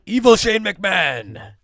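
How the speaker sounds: slow, high sinister villain voice; mad scientist. Pretend. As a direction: ham it up like a cartoon villain. Announcing villain topic.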